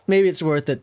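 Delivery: ordinary talk, nothing marked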